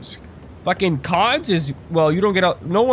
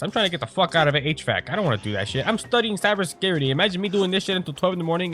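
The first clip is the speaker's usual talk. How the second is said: normal talking voice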